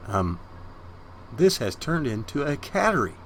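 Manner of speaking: ordinary talk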